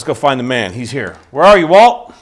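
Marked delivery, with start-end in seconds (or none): 1.3-2.1 loud, high searching call. Pretend. Host going to find the man, calling out loudly. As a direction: holler for someone out of sight. Host calls for his guest.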